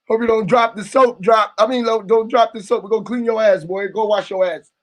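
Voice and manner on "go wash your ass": ordinary talk, nothing marked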